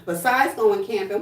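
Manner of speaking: ordinary talk, nothing marked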